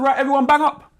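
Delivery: high, loud barked order; guard's order. Retold or imitated. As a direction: call the lockdown loudly. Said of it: "turn around and said".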